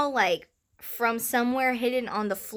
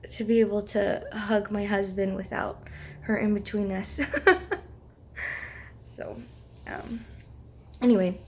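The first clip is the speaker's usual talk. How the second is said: ordinary talk, nothing marked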